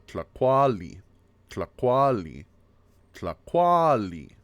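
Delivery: TLACUALI as tla-KWAA-li, middle drawn out and loud; second-syllable stress. Shown as a demonstration. Teacher shows stress in the word for food, exaggerated demo. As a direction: punch the KUA in TLACUALI, stretching it. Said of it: "stress is always on the second syllable".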